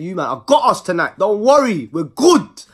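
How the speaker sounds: loud, high mock boast to his mates; boastful shout. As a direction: belt it out like you're treating everyone tonight. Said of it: "So I'm saying".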